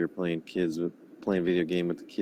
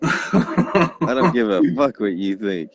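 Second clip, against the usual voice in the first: mock tough-guy voice; 7 dB louder, slower than usual. Mocking friend's rage text.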